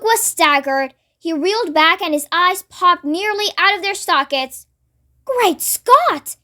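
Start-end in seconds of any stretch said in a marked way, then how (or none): 5.2-6.4 high-pitched exclamation of shock; aghast, swooping up high; astonished gasp. A narrator's reading. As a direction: exclaim as a staggered Duke. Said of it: "he gasped".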